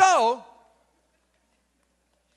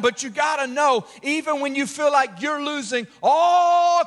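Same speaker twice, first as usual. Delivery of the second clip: full-force preaching; 5 semitones higher than usual. Acted. Pastor rousing the congregation about God's control, full-force preaching. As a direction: preach it big and stirring, pushing the high points.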